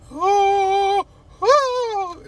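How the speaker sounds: very high, long "oh" sobs; hammed-up bawling; mock crying wail. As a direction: wail in an exaggerated high falsetto. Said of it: "just crying".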